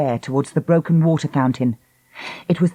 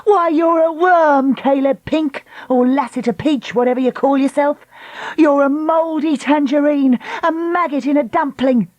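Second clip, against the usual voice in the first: insulting rant; 9 semitones higher than usual. Acted. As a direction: fling each insult. Lucy hurls insults.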